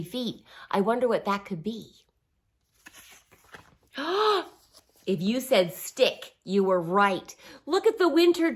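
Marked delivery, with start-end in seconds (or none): 4.0-4.4 high excited gasp. Acted. Reading to kids, high excited gasp. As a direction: gasp as if discovering something. Surprise at the third track.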